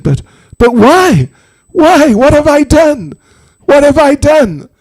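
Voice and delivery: high, loud, desperate wail of protest; Jatila's anguished cry. Acted. Mother-in-law demanding the snake explain, anguished.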